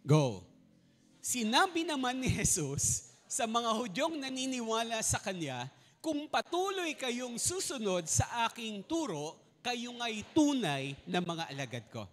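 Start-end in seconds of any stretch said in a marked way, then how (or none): 1.2-12.0 slow, loud unison scripture reading; reading aloud together. Real, not acted. Preacher reading the verse aloud with the congregation, slow and loud. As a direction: read it out loud together, slowly. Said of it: "basahin nga ho natin ng malakas at sabay-sabay".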